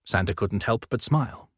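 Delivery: usual speaking voice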